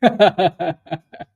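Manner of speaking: loud, high burst of laughter; real helpless laugh. Real, not acted. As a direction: crack up in a long giggle. Laughing at own mispronunciation.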